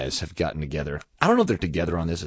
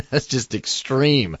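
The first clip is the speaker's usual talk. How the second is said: normal talking voice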